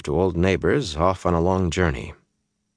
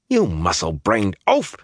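sharp higher snap at him; snapped; 4 semitones higher than usual. A narrator's reading. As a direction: snap it at the oaf. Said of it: "she snapped".

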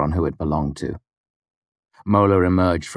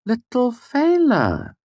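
very high, drawn-out fond coo; fond greeting; 3 dB louder, much slower than usual. A narrator's reading. Devi greets Fela before a hug, high and fond. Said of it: "her expression softened".

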